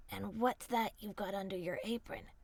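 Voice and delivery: low cunning wolf; sly wolf. A narrator's reading.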